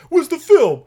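loud, high outraged demand; mock angry shout. Big brother finding his camera messed with, hammed-up angry yell.

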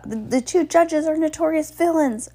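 high, insistent announcement; urgent announcement. A narrator's reading. Klaus announces over the gasping crowd, urgent high announcement. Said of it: "Klaus announced over the gasp".

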